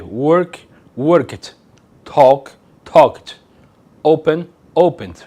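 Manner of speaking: words said slowly and clearly, each stressed; slow clear English demo. Shown as a demonstration. Teacher models verbs slowly for students. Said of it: "como podemos ver".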